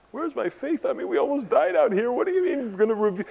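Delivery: high, fast, frantic protest; panicked disciples. Acted. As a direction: blurt it out like a terrified fisherman who nearly drowned. Preacher voices panicked disciples.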